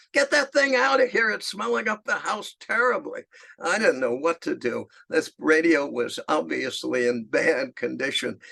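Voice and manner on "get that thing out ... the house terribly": mock a mother's sudden shriek; mother screaming. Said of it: "My mother is screaming".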